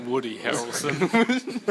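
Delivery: slow laughing name drop. Pretend. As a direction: laugh it out slowly. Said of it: "Matthew McConaughey".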